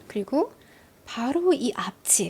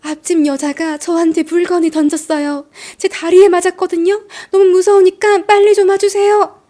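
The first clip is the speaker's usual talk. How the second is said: building from urgent to much higher, louder frightened plea; rising panic, begging police to come fast; panicked 911 call; 7 semitones higher, 8 dB louder than usual. Retold or imitated. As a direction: caller pleading with police dispatcher, frightened. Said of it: "구일일에 신고가 걸려옵니다".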